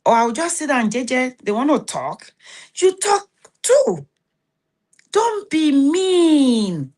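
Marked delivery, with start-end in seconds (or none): 2.8-6.9 high, slow teasing singsong; mocking voice. Mocking someone who wants to talk.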